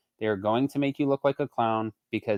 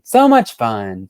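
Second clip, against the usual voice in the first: louder, slow, drawn-out sarcasm on much; sarcastic; 10 dB louder, much slower than usual. Real, not acted. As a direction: drag out so much fun like it's anything but. Sarcastic about 4:30 am drive.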